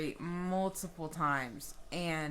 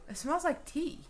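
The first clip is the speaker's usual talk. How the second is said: a lightly voiced, higher friend sniffing; friend's voice; 9 semitones higher than usual. Friend sniffing the air says it, puzzled. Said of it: "She goes".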